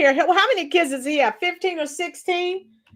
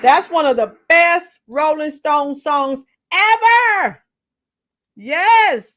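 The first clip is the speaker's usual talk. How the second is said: ordinary talk, nothing marked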